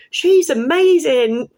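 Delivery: high, loud and slow; slow, emphatic, thrilled gush; gushing praise. Retold or imitated. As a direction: proclaim it to the whole shop. Praising the cashier to a stranger.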